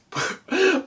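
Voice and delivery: squeaky embarrassed giggle; high giggle. Real, not acted. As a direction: giggle high at own slip. Laughs at own word fumble.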